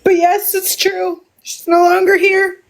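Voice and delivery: real grief, halting between phrases; real emotional, tearful. Real, not acted. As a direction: struggle through tears to admit it. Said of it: "excuse me while I get emotional".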